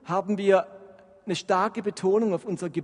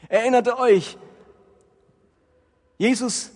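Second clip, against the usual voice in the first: louder, higher preacher voice building the point; impassioned preaching; 4 semitones higher, 5 dB louder than usual. Acted. Preacher rousing the congregation, louder and higher.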